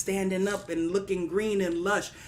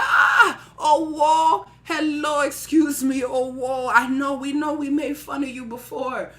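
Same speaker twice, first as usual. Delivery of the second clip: higher, louder calling out to get attention; eager appeal; 5 semitones higher, 7 dB louder than usual. Acted. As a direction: hail them loudly, then start owning up. Townsfolk hail Money for help.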